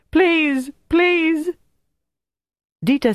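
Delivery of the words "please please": very high, desperate pleas; crying out. A narrator's reading. Tortured prisoner begs his torturers to stop, crying out. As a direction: beg in a high, frightened cry. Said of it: "Bertrand cried".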